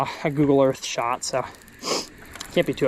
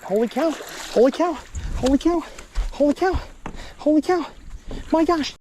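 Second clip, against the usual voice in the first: excited; 10 semitones higher than usual. Real, not acted. Repeated exclamations building up.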